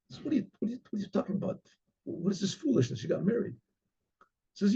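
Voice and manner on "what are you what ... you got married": much softer, dazed disbelief; father stunned at his daughter's news. Acted. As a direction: let it fall out quietly in shock.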